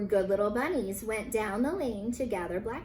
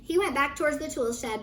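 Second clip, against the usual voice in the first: plain reading voice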